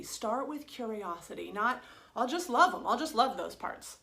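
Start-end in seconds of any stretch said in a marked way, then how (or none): 2.2-2.8 loud, forced eagerness; the mind grabbing at a quick fix, loud and keen; eager mind voice. Retold or imitated. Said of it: "That's the mind saying". 2.9-4.0 eager mind, repeated. Retold or imitated. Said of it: "That's the mind saying".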